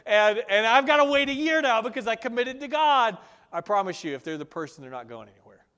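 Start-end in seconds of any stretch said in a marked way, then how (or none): 0.0-3.2 high, loud, breathless excitement; exclaiming in loud, high-pitched delighted disbelief; giddy disbelief. Retold or imitated. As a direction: gush like you can't believe your luck. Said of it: "And you're gonna be like".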